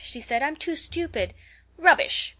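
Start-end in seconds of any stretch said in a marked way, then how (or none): 0.4-1.3 high-pitched embarrassed protest; bashful protest. A narrator's reading. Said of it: "blushing and protesting". 1.8-2.4 loud, high exclamation; crying out. A narrator's reading. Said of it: "cried Rose".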